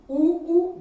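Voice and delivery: उ, उ called out loud and high; emphasised vowel. Shown as a demonstration. Teacher stressing the vowel उ, loud and high. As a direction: punch each उ for the class. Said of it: "इसमें भी".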